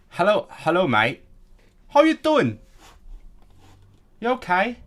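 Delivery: high, loud, hammed-up hello; playful exaggerated shout to a stranger; put-on greeting.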